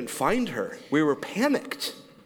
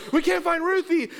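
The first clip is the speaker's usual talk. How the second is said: high, urgent call; mother telling neighbor kids, alarmed; alarmed announcement; 12 semitones higher than usual. Retold or imitated. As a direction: announce it to the whole street. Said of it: "She announces to the neighbor kids".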